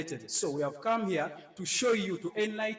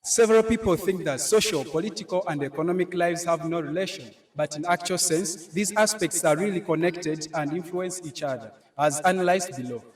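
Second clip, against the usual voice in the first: loud, raised public oration; declaiming speech; 2 semitones higher, 10 dB louder than usual. Acted. Head boy speaking to the audience, loud declaiming oration. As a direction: project it to the whole hall.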